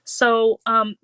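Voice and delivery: ordinary talk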